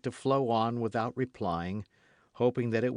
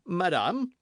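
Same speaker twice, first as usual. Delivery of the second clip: higher, louder royal address; dignified king; 9 semitones higher, 5 dB louder than usual. A narrator's reading. King addressing the queen with cold dignity. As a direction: call her coldly as a king. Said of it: "said he, with dignity".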